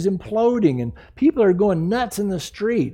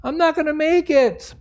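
acted despair; 10 semitones higher than usual. Believer overwhelmed in a horrible trial, acted panic. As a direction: cry out as if sinking. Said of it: "horrible trial or tribulation or struggle".

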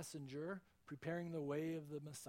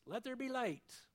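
booming command; 7 semitones higher, 4 dB louder than usual. Acted. Preacher voicing God's creating word, loud proclamation. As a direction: proclaim "Let there be light" loudly, voice raised high. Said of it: "he didn't have to shout it".

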